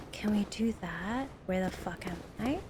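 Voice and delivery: soft unvoiced whisper. Real, not acted. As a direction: breathe the question out. Whispered aside while gaming.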